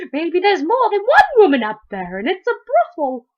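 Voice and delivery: put-on high, loud little-girl voice; eager girl Polly. A narrator's reading. Girl guesses what is hidden upstairs, high and eager. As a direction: pipe up high with a speculation.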